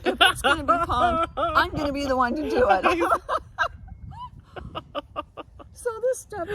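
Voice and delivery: giggling, defiant vow; laughing. Retold or imitated. Telling a friend her pawnshop plan, laughing loudly. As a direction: laugh through the words, loud and fed up.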